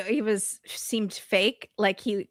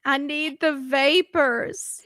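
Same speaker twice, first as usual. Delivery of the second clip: slow, higher swooning melodrama; mock swooning; 5 semitones higher, slower than usual. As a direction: draw it out like a fainting drama queen. Co-host joins the mock melodrama.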